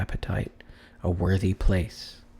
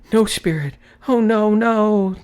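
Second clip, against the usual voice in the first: anguished denial; 13 semitones higher, 8 dB louder than usual. Acted. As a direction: wail against the fate he sees. Scrooge denying his fate.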